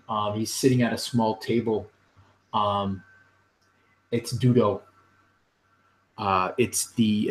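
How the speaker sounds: ordinary talk, nothing marked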